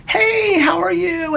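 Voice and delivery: high, bright put-on cheer for an old classmate; fake cheerful greeting. Greeting a high school acquaintance he barely knew, high fake cheer.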